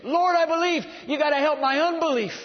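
high, loud pleading cry; preacher acting a desperate plea; tearful cry out. Father of the child begging Jesus, crying out. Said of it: "cried out and said with tears".

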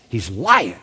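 normal talking voice

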